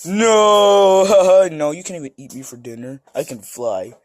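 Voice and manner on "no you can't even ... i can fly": ordinary delivery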